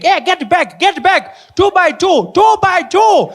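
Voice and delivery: high, loud frantic calls; a teacher losing control of her class; panicking teacher. Retold or imitated. Teacher yelling at her runaway schoolkids, high and loud. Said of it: "She's panicking, like".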